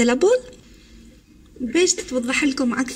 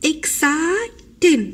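"Exciting" very slow and higher, as ex, ci, ting; syllable by syllable; 4 semitones higher, much slower than usual. Shown as a demonstration. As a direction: stretch "Exciting" into three drawn-out beats. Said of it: "هم three syllables".

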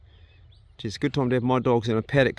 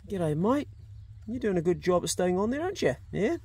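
talking to animals; 5 semitones higher, 6 dB quieter than usual. Real, not acted. Talking to ducks on pond.

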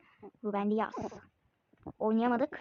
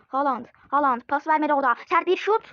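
louder, rising calls; excited build-up; 3 semitones higher, 6 dB louder than usual. Real, not acted. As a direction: hype the hard shot. Attack building to shot.

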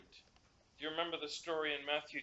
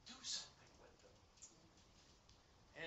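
hushed urging; 15 dB quieter, much slower than usual. Retold or imitated. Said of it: "God says to you".

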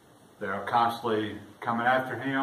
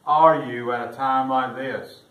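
crying out to God; 3 semitones higher, 9 dB louder, slower than usual. Retold or imitated. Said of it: "Or we may say".

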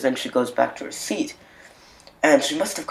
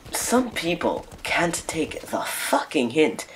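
slow whispered aside, a little higher; exasperated whisper; 4 semitones higher, 5 dB quieter, slower than usual. Real, not acted. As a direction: hiss the complaint under your breath. Muttered complaint aside to viewers.

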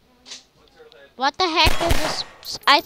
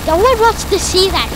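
loud fast shout; loud fast angry yell; 6 semitones higher, 8 dB louder, faster than usual. Real, not acted. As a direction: yell it at him quickly. Shouting at Freddy.

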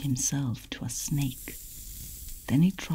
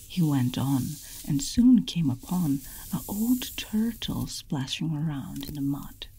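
usual speaking voice

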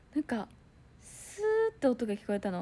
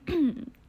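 loud throat clear; 4 semitones higher, 8 dB louder than usual. Retold or imitated. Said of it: "って言って".

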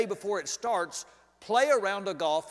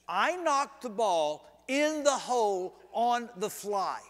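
usual speaking voice

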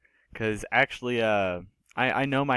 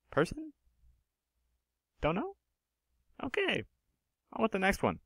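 sheepish; 10 semitones higher, 5 dB quieter than usual. Real, not acted. Awkward joking wrap-up.

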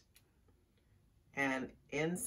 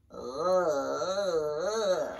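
long, wobbling, loud "ugh"; disgusted groan; 5 dB louder than usual. A narrator's reading. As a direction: groan as if hit by a stink. Possum sprayed by skunk stink.

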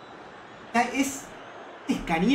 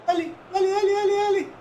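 urgent cheering; 12 semitones higher than usual. Real, not acted. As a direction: egg the player on, rising. Jugada de ataque en curso. (aliento urgente a gritos)

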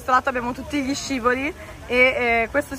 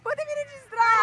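much higher exasperated whine; high indignant; 12 semitones higher than usual. Real, not acted. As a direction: complain it in disbelief. Scolding sister after slide.

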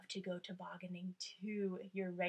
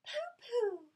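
high, loud scoffing pooh; Madeline's dismissive pooh; 13 semitones higher, 6 dB louder than usual. Acted. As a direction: brush off the tiger. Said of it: "Madeline just said".

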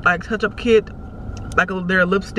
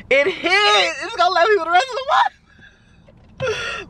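gleeful squeal; 11 semitones higher, slower than usual. Real, not acted. Thrilled with her new lashes.

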